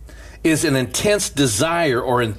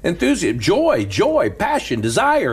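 higher, ringing preacher's voice; preaching with fervor; 6 semitones higher than usual. Acted. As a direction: preach it out and lift the room. Chanting a list of sermon words.

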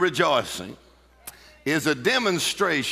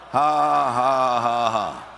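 deliberate, sustained "ha ha" laugh, descending; practice laugh; 2 semitones lower, much slower than usual. Shown as a demonstration. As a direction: model a defiant laugh for the congregation. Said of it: "Go ahead and practice that".

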